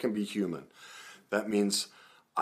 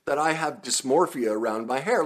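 ordinary talk, nothing marked

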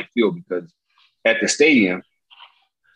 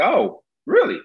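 mock surprise; slower than usual. Teller hears Jordan is nearby.

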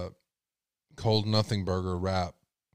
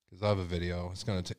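ordinary talk

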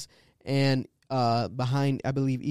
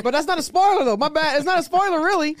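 high, loud flustered denial; panicked backpedal; 12 semitones higher, 6 dB louder than usual. Real, not acted. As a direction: scramble to take it back. Panics after nearly spoiling.

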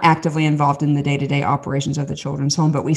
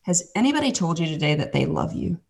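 softer, gently retold question; tender; 6 dB quieter than usual. Lady asking the hiding kid, tender and soft. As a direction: ask it softly, as if to a shy child.

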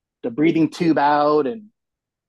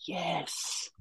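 weak rasped yes; 3 semitones higher, 14 dB quieter than usual. Retold or imitated. Desperately thirsty patient.

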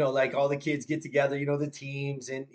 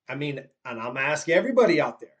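ordinary talk

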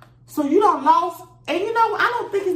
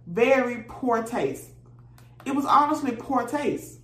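ordinary talk

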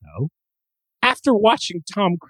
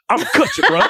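put-on high, laughing Brooklyn accent; joke-threaten like a Brooklyn barbershop guy; Brooklyn dude; 7 semitones higher, much faster than usual. Mocking an actor's slipping accent, Brooklyn dude. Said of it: "dude I meet at my barbershop in Brooklyn".

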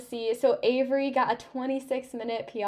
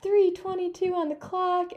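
high-pitched excited announcement; encouraging cheer; 9 semitones higher than usual. Retold or imitated. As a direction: imitate a friend cheering the time. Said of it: "she was just so encouraging".

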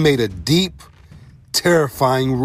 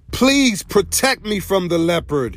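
higher-pitched plea for protection; pleading cry; 7 semitones higher than usual. A narrator's reading. Wounded dog begs the hunter for protection, crying out. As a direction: beg for shelter in a raised voice. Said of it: "he cried".

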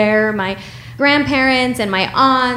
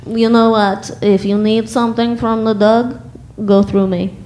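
put-on Russian accent. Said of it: "my mom would eventually say".

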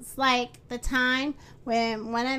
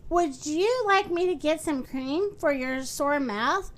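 usual speaking voice

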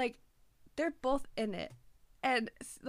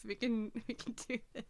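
tearful; 5 dB quieter than usual. Real, not acted. Steadying self while tearful.

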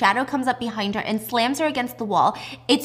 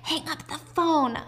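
slow, high plea while being choked; strained begging; 6 semitones higher, 4 dB quieter, slower than usual. Retold or imitated. Choked mother begging for the call to end, strained and slow. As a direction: beg with drawn-out words, higher than usual. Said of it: "begging for him".

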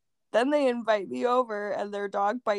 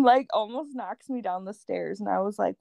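ordinary delivery

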